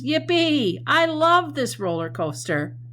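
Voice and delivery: high, loud thrilled whoop; hammed-up joy, pitched high and loud; excited cheer. Shown as a demonstration. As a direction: cheer like you're riding a roller coaster. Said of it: "They're being said with a lot of feeling".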